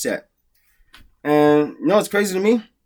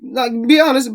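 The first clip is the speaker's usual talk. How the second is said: ordinary delivery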